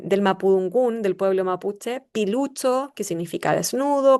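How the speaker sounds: ordinary talk, nothing marked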